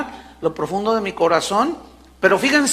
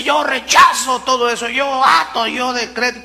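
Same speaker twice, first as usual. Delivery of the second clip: mock loud, higher decree-shouting; mocking declaration; 5 semitones higher, 3 dB louder than usual. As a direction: parody the name-it-claim-it preacher. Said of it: "quién sabe cuánto rollo". (imitando burlón un decreto a gritos)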